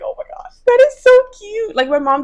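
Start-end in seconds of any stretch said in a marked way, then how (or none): 0.0-0.5 whispered gasp of delight; hushed, retold amazement; awed whisper. Awed reaction before a squeal. 0.7-1.8 very high, loud squeal of adoration; acted-out, piercingly high gushing; delighted squeal. Acts out delighted reaction.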